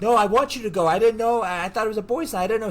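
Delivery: high, loud start, rushing into excuses; mock frantic protest. Boyfriend placating screaming girlfriend, mock frantic protest. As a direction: backpedal urgently, imitating a cornered boyfriend.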